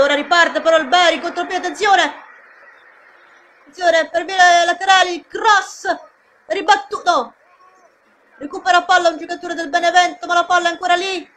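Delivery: high and fast, rising alarm; breathless italian goal commentary. Acted. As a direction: shout the danger as it unfolds. Counterattack, cross, scramble.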